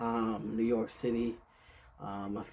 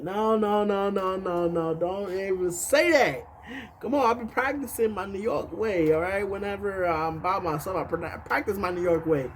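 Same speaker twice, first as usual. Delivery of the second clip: protesting; 6 semitones higher than usual. Retold or imitated. Telling a friend he has no Texan accent, protesting. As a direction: drag out the protest, higher and insistent.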